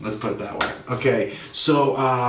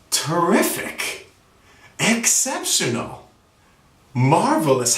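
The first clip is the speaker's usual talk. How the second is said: savored slowly; 4 semitones higher, much slower than usual. Acted. Enthusiastic reply example.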